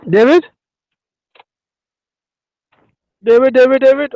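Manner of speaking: high, loud, insistent calls; urgent high-pitched shout for someone; calling out. Real, not acted. Calling out to David.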